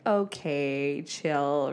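low, drawn-out put-down from an inner critic; ego-driven inner voice. Acted. Ego voice telling Danielle to chill, dismissive. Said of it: "that little ego-driven voice that is like".

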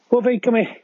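high call to a pet; calling a dog. Real, not acted.

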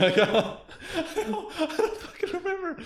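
high, giggling words through real laughter; can barely get it out for laughing; laughing hard. Real, not acted. Cracking up retelling his prank.

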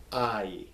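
"Ai" drawn out slowly as "ah-ee"; slow teaching "Ai", ah gliding into ee; both vowels pronounced. Shown as a demonstration. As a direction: stretch "Ai" through both vowels. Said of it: "You pronounce them both".

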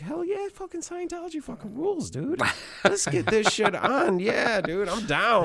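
high, hyped-up bro yelling; excited fanboy. Retold or imitated. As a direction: gush like a total convert. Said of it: "And I'm like".

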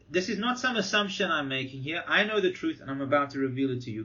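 normal talking voice

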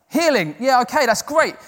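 high, loud, eager retold reaction; enthusiastic approval. Preacher imitating his reaction to healing stories, enthusiastic approval. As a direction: act out ready agreement, pitched right up. Acting out his delight at testimonies.

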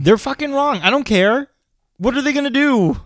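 high, loud defiance; defiant. Real, not acted. Brushing off angry listeners, high and defiant. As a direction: throw it back at the critics. Defiant about Christmas movie debate.

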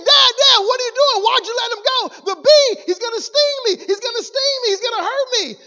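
put-on high, loud protest of a frightened child; imitated panicked kid. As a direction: plead with Dad in an alarmed boy's voice. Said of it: "kid says again".

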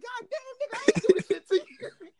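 high, squealed, laughing protest; comic high-pitched outcry breaking into laughter; retold alarmed protest. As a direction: reenact pleading innocence, voice way up. Retold protest at plates thrown his way.

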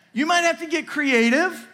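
normal talking voice

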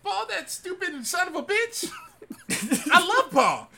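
high laughing voice. Real, not acted. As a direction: giggle through the insult. Mocking song, laughing.

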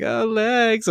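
high, silly "Oh, legs"; mock leer. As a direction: squeal it like a leering teen. Mocking turtles ogling.

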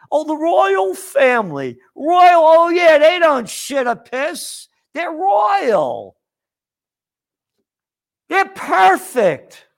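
high, sneering mock adoration of the royals; mocking royal fan. Ranting host mocks royal admirers, high sarcastic voice.

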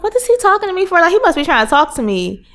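high-pitched retold bewilderment; puzzled inner voice. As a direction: act out being baffled by a stranger. Voices her puzzled past thoughts.

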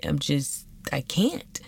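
normal talking voice

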